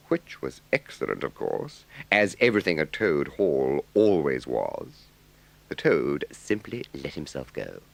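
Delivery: ordinary delivery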